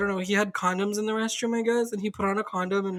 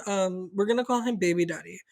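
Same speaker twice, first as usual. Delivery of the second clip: normal talking voice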